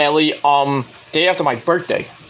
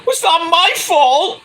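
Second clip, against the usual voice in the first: much higher, slow, whiny protest; high put-on girl's voice, slowly defending herself; whiny denial; 12 semitones higher, slower than usual. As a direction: drag out a childish it's-not-me. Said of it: "And she's like".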